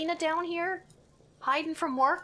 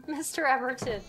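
usual speaking voice

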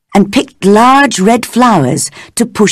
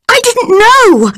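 much higher, desperate denial; scream. A narrator's reading. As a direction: shriek the denial in panic. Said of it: "she screamed".

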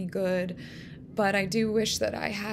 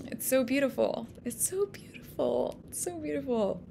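hushed awe; 6 semitones higher than usual. Real, not acted. Moved, repeating softly.

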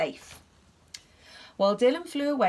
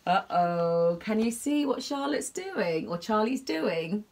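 usual speaking voice

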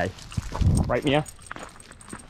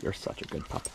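whisper; 6 semitones lower, 13 dB quieter than usual. Real, not acted. Whispered praise to dog.

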